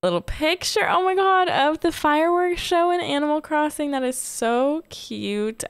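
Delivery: delighted fan reaction; excited gushing. Real, not acted. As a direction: gush over the cute picture. Excited over fan art.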